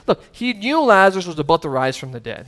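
ordinary talk

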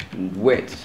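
ordinary delivery

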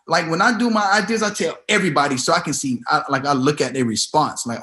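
usual speaking voice

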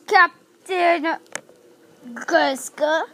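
ordinary talk

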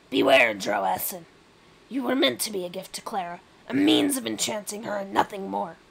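loud, aggressive Rat King warning. Acted. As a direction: warn him forcefully as the rat. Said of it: "that is an aggressive voice".

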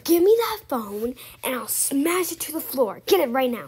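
furious yell; angry shouting. Acted. Babysitter video played back.

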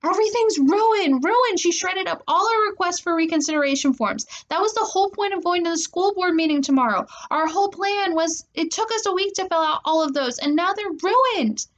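high, wailing indignation; distraught outburst. A narrator's reading. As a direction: pour out despair over ruined plans.

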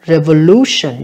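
Revolution, with the third syllable punched; stress on third syllable. Shown as a demonstration. Teacher showing students the stress, third-syllable stress on Revolution. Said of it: "nhấn âm thứ ba".

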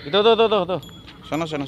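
loud high calls across the pen; calling out. Real, not acted. Calling to the goat feeding kid.

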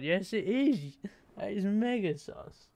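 ordinary talk, nothing marked

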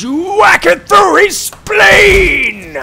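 high, slow screaming war cry; battle cry. Pretend. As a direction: scream it as you strike. Mock battle cry stabbing.

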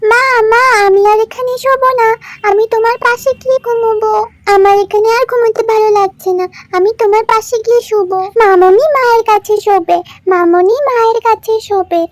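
put-on high child voice begging to sleep beside mum; a small girl pleading with her mother; little girl whining. Little daughter begs sick mother, high whiny child voice.

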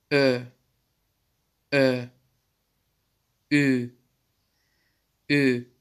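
Ö and Ü said slowly as rounded front vowels; German umlauts. Shown as a demonstration. Teacher showing students the umlaut sounds, clearly. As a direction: round the lips for Ö and Ü. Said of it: "bir tık daha kalın okunur".

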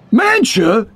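high, rising cry of disbelief; astonished cry. A narrator's reading. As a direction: cry out the name in shock. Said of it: "he cried".

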